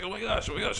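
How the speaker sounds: mock panic. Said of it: "It's like".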